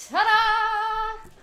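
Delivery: sung high and very loud; triumphant ta-da. Acted. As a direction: present it like a magic trick reveal. Unveiling a surprise prop.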